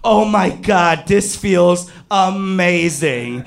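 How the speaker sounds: high, stretched-out acted bliss; explosion of pleasure. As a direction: draw out the words in comic ecstasy. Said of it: "in my head there was an explosion".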